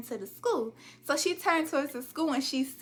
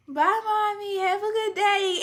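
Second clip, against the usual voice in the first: high, bright sing-song sweetness, louder; fake cheerful goodbye; 8 semitones higher, 6 dB louder than usual. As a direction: play the overly sweet daughter. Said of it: "I don't say that 'cause I'm not a morning person".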